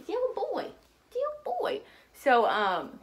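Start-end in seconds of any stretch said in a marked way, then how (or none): 0.0-0.7 tender high coo, softer. Real, not acted. Cooing at her baby son. 1.1-1.8 adoring high coo, softer. Real, not acted. Cooing at her baby son.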